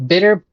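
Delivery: sounding out bitter; loud puzzled bitter. Shown as a demonstration. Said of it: "not sure what that's supposed to mean".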